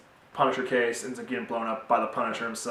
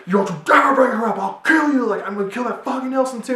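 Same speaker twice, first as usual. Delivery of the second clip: high, loud mock rage; hammed-up unhinged shouting; furious yelling; 12 semitones higher, 9 dB louder than usual. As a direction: yell threats like someone losing it. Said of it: "He just goes nuts and just yells at him".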